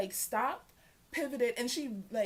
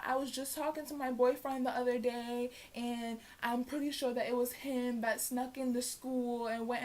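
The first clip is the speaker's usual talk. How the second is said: mock innocent girl; 2 semitones higher than usual. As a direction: play dumb, all sweetness. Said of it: "she was like".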